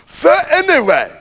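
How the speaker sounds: high, hearty put-on voice; blustering storyteller launching into a tale; pompous hunter holding forth; boastful hunter.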